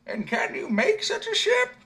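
put-on very high, slow squeaky old man; a tiny creaky old man doubting him; little old man questioning the simpleton. A narrator's reading. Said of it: "said the little man".

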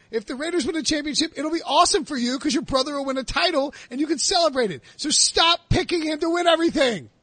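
high-pitched exasperated rant. Real, not acted. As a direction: vent at him, pitch climbing up. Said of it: "That was a great diatribe".

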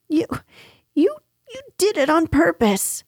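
high, halting, indignant stammer; spluttering Piglet. A narrator's reading. Piglet with a mouthful of soap, spluttering. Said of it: "spluttered Piglet".

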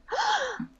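high, quiet imitated gasp; theatrical intake of breath; mock gasp. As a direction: gasp like a shocked bad actress. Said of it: "and them going".

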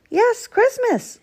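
high, slow cheery call; badger's high-pitched call. A narrator's reading. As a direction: call it out high, drawing the words out. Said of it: "called Badger".

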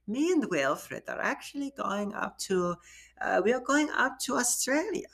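high-pitched put-on German accent. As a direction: do an elderly neighbor's German accent. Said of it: "do it in a German accent".

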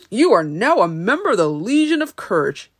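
loud, swooping ceremonial proclamation; theatrical, emphatic award speech; Wizard proclaiming. Acted. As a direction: confer it like a grand honour. Wizard solemnly confers honour on Lion.

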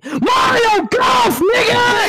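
high, loud, slow excited yell; hype shout. Real, not acted. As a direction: shout it like a fan losing it. Hype shout over game news.

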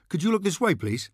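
high, coaxing photographer voice; cheerful press photographer calling to Thorne; eager photographer. Acted. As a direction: call out to grab his attention.

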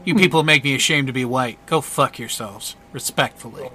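low, quick, understated scolding; contemptuous politeness. Acted. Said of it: "he goes".

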